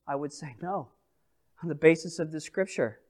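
hushed confession. Real, not acted. Preacher's quiet personal answer.

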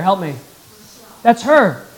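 usual speaking voice

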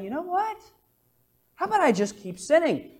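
hint of a sly sinner; sly suggestion. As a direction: play the clever loophole seeker. Voicing the sinner's logic.

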